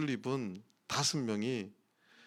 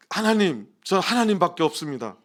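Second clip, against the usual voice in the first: loud, raised voice calling on God; earnest plea; 4 semitones higher, 7 dB louder than usual. Retold or imitated. Pastor recalling his prayer to God in fear, earnest plea. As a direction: cry it out as a desperate prayer. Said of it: "이 생각이 막 간절히 드는 거예요".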